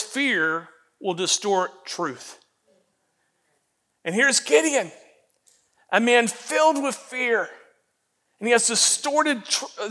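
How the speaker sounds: ordinary delivery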